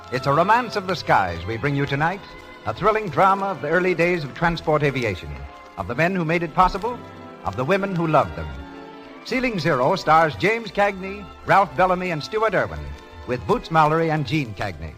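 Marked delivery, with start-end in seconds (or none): none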